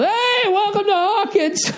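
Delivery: high, drawn-out mock welcome shout; imitated group yell. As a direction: holler a long, high welcome like a whole kitchen crew. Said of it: "everybody in the kitchen yelled".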